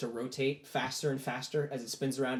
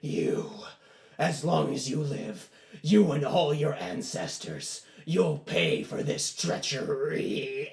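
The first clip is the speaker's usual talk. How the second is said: slow, drawn-out demon voice; demon's curse; slower than usual. Acted. Demon cursing the boy as it is pulled in, slow menace. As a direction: stretch each threat, dragging out the last word. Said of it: "huge demonic-like form".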